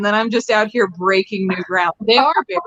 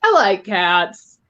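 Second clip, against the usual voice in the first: breathless laughing through words; 4 semitones higher, much slower than usual. Real, not acted. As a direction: still giggling, slowed down. Laughing fit after punchline.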